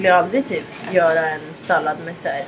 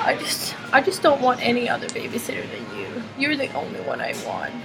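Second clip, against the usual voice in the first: soft whispered confession; 4 semitones higher, 6 dB quieter than usual. Retold or imitated. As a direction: breathe it like a secret at bedtime. Child's bedtime confession. (viskande och tyst)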